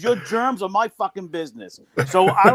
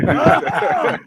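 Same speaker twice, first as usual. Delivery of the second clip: loud high mock ahem aimed at him; pointed fake throat clear; 11 semitones higher, 4 dB louder than usual. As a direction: clear your throat loudly to shame him. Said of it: "And I'm going".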